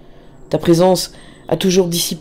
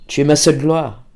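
usual speaking voice

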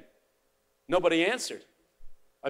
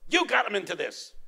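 accusing yell, louder; angry father blaming the teacher, louder and higher; 8 semitones higher, 6 dB louder than usual. Retold or imitated. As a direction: yell it at the professor. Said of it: "yelled at me and said".

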